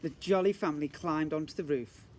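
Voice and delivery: ordinary delivery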